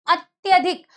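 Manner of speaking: normal talking voice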